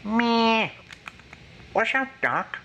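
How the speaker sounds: high cartoon rabbit voice, casual; Bugs Bunny. Shown as a demonstration. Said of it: "the Bugs Bunny impression".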